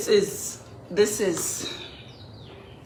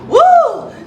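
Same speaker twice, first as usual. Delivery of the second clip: loud, high whoop of triumph; cheering whoop; 11 dB louder than usual. Real, not acted. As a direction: whoop it out to pump yourself up. Cheering herself on.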